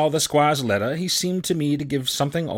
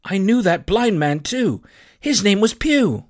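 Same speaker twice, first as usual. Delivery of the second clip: high, loud, earnest voice; eager boy; 6 semitones higher, 4 dB louder than usual. Acted. Boy tells Silver of the blind man, eager. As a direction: blurt it out keenly, pitched up.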